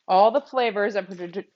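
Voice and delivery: ordinary talk, nothing marked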